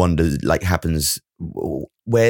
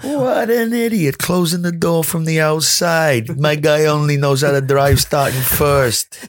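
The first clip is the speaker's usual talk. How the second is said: high, slow, drawn-out indignant mimicry; mocking radio outburst; 9 semitones higher, slower than usual. Co-host mimicking Alonso raging on team radio, high mock indignation. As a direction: stretch every word in a high put-on voice.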